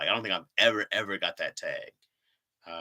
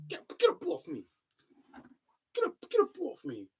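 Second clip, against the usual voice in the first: high squeaky voice; 5 dB quieter than usual. Real, not acted. Tangled, talking to something on her.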